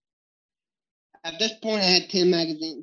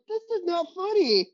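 indignant protest; 13 semitones higher than usual. Retold or imitated. Girlfriend laughing at story.

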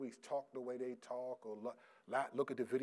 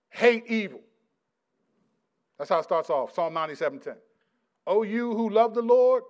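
full-force preaching; 5 semitones higher, 12 dB louder than usual. Real, not acted. Preacher reading the Psalms to his congregation, full-force preaching. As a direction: proclaim the verse to the whole church.

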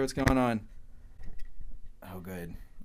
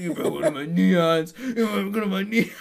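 high, loud mock outrage; mad, retold; 5 semitones higher, 7 dB louder than usual. Bartender accusing employee over broken neon, mock mad. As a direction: mimic a wailing, aggrieved complaint. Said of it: "He was so mad".

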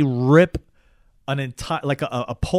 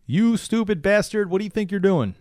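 mock yell; 6 semitones higher than usual. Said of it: "they're like".

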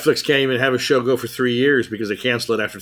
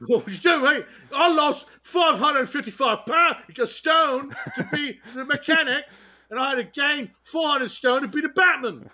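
much higher mock English voice, laughing; high hammed British bluster; mock Bale; 13 semitones higher than usual. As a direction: boast about weight like a pompous star. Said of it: "Bale parody".

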